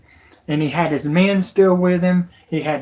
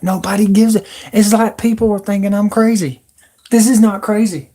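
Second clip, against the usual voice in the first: higher and louder, fired up and exasperated; agitated outburst; 5 semitones higher, 6 dB louder than usual. Real, not acted. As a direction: insist hard that you're not crazy. Ranting that nobody believes him.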